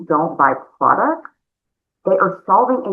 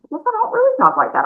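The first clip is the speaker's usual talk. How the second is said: much higher, quick imitating voice; mock customer voice; faster than usual. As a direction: squeak her reply in a high voice. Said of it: "And she goes".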